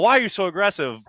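a fan hamming up outrage at the coach; mock exasperated shout. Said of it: "and I'm like".

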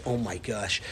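very soft, low sympathy; hushed, low empathetic reaction; understanding response. Shown as a demonstration. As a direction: breathe out quiet concern for an upset child. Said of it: "How about if you understand".

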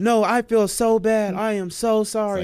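high-pitched, mock-remorseful lady; exaggerated earnest apology; pleading apology imitation. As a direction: beg in her put-on high voice.

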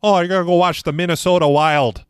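mock the Minnesota accent. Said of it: "People get mad when you do that accent".

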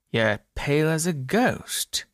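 lightly voiced bartender remark. A narrator's reading. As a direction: tease the pale man gently, slowly. Bartender remarks on pale customer.

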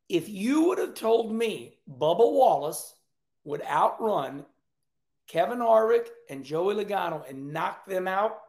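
slow, with pauses between phrases; deliberately slow. Shown as a demonstration. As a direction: spell it out slowly so it sinks in. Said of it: "I'm gonna say it slow".

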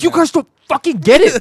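high, loud hammed-up protest; exasperated fan outburst. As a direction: shout it at clueless coworkers. Retold starstruck outburst at coworkers.

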